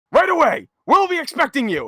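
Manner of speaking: high-pitched, obliging quote; eager phone reply. Retold or imitated. Sid on the phone with the president, high and eager. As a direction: play the eager underling on the phone. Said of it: "he's on the phone with the president".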